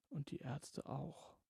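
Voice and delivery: soft, slow, secretive mutter; furtive murmur. A narrator's reading. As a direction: murmur it so no one hears. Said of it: "murmelte sie verstohlen". (verstohlen murmelnd)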